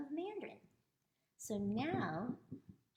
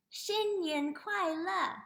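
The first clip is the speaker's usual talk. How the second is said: slow, high, loud model phrase; teaching demo; 9 semitones higher, 5 dB louder, much slower than usual. Shown as a demonstration. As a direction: sound it out slowly and cheerfully for repeating. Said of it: "Can you say that?".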